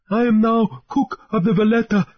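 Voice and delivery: high, spluttering sailor; high, sputtering speech; spitting and spluttering. A narrator's reading. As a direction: force the words past the defect. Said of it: "he spat and spluttered".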